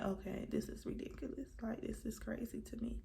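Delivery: quiet muttered aside. Retold or imitated. Muttered quote to herself.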